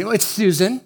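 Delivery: ordinary talk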